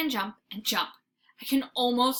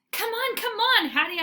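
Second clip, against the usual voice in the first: high, loud impatient urging; dog urging his girl out the car; eager barking; 12 semitones higher, 6 dB louder than usual. Acted. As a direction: hurry her up excitedly. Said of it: "I bark".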